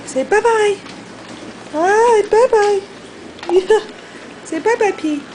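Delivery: high sing-song farewell; high bye-bye. Real, not acted. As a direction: wave goodbye sweetly. Bye to camera.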